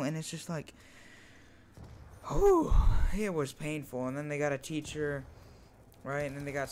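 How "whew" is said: pained whew. Retold or imitated. Recalling the pain.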